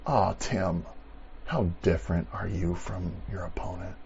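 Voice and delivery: whispered, gentle inner voice; soft rebuke. Retold or imitated. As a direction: hush it like God speaking softly. God gently rebuking the speaker.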